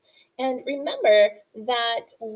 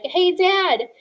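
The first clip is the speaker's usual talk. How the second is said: put-on kid asking dad; 7 semitones higher, 5 dB louder than usual. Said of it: "I used to ask my dad things like".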